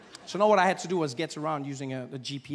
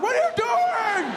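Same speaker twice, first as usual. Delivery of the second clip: very high, loud mock shout; outraged yell; 5 dB louder, slower than usual. Stopped drivers yelling at a wrong-way driver, high outraged shout. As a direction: yell it like an appalled onlooker.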